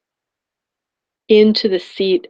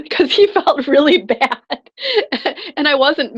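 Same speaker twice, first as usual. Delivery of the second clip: laughing; 8 semitones higher, 3 dB louder than usual. Real, not acted. Laughing through the story.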